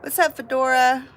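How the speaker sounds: excited greeting. Real, not acted. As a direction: call out happily to a friend who just joined. Greeting a viewer arriving in chat.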